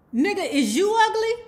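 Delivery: high, loud indignant retort; indignant comeback. Retold or imitated. Winter throws the question back indignantly.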